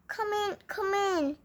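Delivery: high, soft put-on granny voice; wolf lightly mimicking a sweet old lady; wolf posing as Granny.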